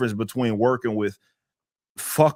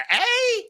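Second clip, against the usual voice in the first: high laugh. Real, not acted. Laughing at mockery.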